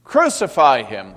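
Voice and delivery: a loud mob shout; crowd shout. Acted. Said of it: "they shouted".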